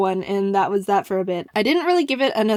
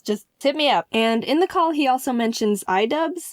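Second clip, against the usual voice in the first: ordinary talk, nothing marked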